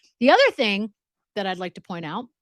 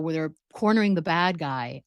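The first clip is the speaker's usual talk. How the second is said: ordinary talk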